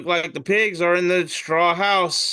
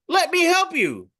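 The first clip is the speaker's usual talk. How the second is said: high-pitched cartoon helper voice; cartoon smiley axe. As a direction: pipe it up like a chirpy cartoon object. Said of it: "cartoon picture of a smiley axe".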